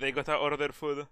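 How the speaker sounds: ordinary delivery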